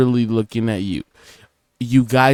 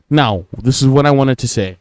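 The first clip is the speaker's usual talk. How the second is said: ordinary talk, nothing marked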